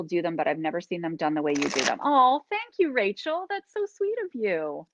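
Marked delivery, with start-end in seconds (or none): 2.0-4.9 high, bright gush of thanks; squealing with real delight. Real, not acted. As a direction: gush back, really touched. Touched by viewer's compliment.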